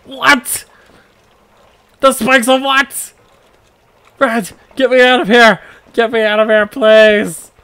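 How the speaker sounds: terrified pleading. Acted. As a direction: beg for rescue in panic. Trapped as spikes descend.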